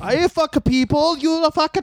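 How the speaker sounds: high mock Italian accent, slowed down. Cohost joining the bit in a mock Italian accent. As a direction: ham up a bad Italian accent. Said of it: "That was Italian".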